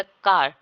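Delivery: कार said loud, long open aa; pronouncing car. Shown as a demonstration. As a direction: demo कार with the final R dropped. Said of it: "rhotic sound क्या लगेगा".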